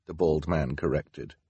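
ordinary delivery

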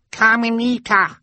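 very high, squealed call; toddler's cry. A narrator's reading. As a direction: cry out in a tiny child's pitch. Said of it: "Sunny cried".

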